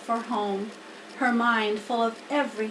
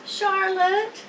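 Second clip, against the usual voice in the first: ordinary delivery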